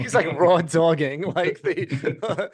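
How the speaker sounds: slipped into a thick Long Island accent; Long Island accent slipping out. Real, not acted. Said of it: "my Long Island accent came out so bad just then".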